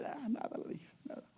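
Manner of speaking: quietly waving it away; hushed dismissal. Retold or imitated. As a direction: brush it off under your breath. His own reply, retold.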